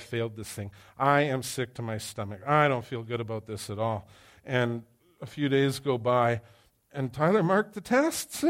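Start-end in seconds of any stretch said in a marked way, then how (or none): none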